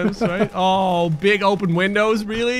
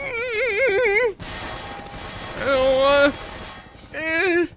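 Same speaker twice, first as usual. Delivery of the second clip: mock whimper; 10 semitones higher, much slower than usual. Real, not acted. Whimpering at enemies.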